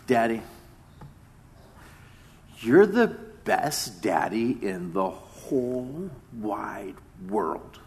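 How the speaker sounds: slow, soft imitation of a four-year-old; little boy. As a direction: stretch it out like a small boy praising his dad. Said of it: "my little boy that just turned four".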